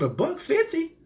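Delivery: very high, astonished price check; stunned bargain-hunter's squeak; incredulous question. Retold or imitated. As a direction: pitch it way up in disbelief. Retold amazed question on price.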